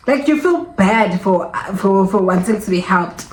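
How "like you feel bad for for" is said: usual speaking voice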